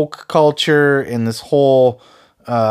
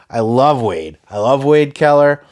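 ordinary delivery

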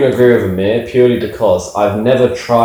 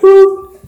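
high, louder beep-like boop; a sharp high button boop; imitated boop; 6 dB louder than usual. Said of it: "And she went".

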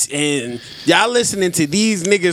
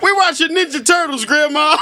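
put-on kid voice; 9 semitones higher than usual. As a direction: shout it back like a grandkid caught out. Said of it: "It's like".

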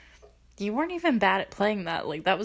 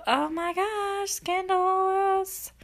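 high, slow, drawn-out oh my gosh; mock-scandalized swoon; scandalized gasp; 10 semitones higher, 3 dB quieter, much slower than usual. Real, not acted. Viewer gushing to camera about the couple, high drawn-out scandalized voice. Gushing over a steamy scene.

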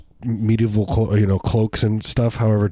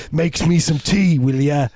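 put-on high, slow Australian accent sliding into Cockney; hammed-up Aussie ordering people about; drawn-out Australian twang with a Cockney slip; Crocodile Dundee; 6 semitones higher, slower than usual. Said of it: "your Australian accent is".

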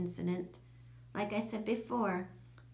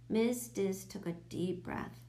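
ordinary delivery

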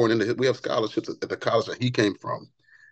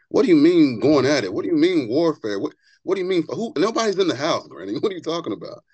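higher-pitched, baffled retold questions; bewildered questioning; 6 semitones higher than usual. Grandson questioning his praying granny at night, retold bewilderment.

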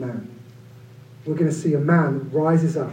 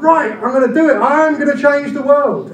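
higher, louder, fired-up mock resolve; gung-ho eagerness; 9 semitones higher, 5 dB louder than usual. Preacher mimicking the quick activist, hammed-up excitement. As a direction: play the overeager hero ready to change the world.

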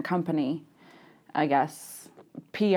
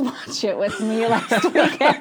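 laughing through the words; 3 semitones higher, 3 dB louder than usual. Real, not acted. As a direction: giggle while you say it. Laughing while telling.

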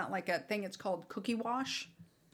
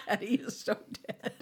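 laughing mock threat. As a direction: chuckle the threat through laughter. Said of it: "And I went".

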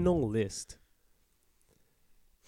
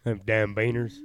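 lightly put-on country accent; a hint of country twang, played for laughs; mock country accent; 4 dB louder than usual. Shown as a demonstration. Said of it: "Do a country accent".

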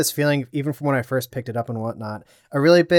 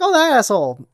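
annoyed; 8 semitones higher, 5 dB louder than usual. Retold or imitated. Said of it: "and I was like".

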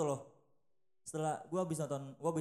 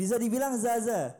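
repeated louder, close to the mic; 5 semitones higher, 7 dB louder than usual. Real, not acted. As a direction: say it again clearly and louder. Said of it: "Deketin mic lu aja". (lebih keras)

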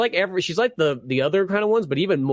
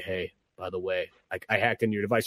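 much lower and much softer, hushed; sneaky hacker; 9 semitones lower, 6 dB quieter than usual. Retold or imitated. A stranger on the phone confessing a hack, hushed and low. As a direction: slip it in low like a secret caller.

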